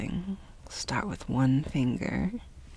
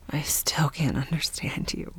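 ordinary delivery

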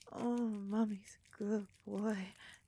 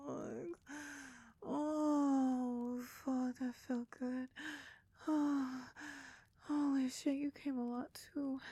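high, loud and breathless; moaning climax; 4 semitones higher, 5 dB louder than usual. Acted. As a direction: cry out in rising pleasure.

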